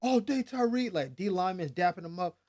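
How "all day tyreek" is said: high, loud teammates cheering; sideline cheer. Retold or imitated. Teammates shouting back on the sideline, loud cheer. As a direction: cheer him back, hyped. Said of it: "And the sideline's like".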